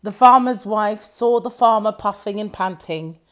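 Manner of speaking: usual speaking voice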